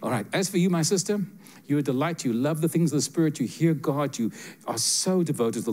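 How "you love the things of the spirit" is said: ordinary talk, nothing marked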